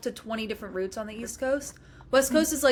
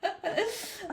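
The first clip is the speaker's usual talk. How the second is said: high laugh; 10 semitones higher, 6 dB quieter than usual. Real, not acted. Laughs at memory.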